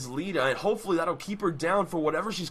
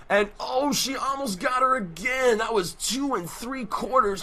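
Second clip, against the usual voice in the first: excited shout; 7 semitones higher than usual. Acted. Near-fall excited call.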